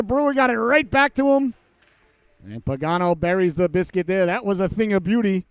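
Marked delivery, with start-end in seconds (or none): 0.0-1.5 excited replay of the goal; excited recap. Real, not acted. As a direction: still high and lively retelling.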